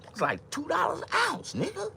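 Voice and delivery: very high, squeaky price complaint; squealing outcry. Acted. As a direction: shriek the price in a falsetto.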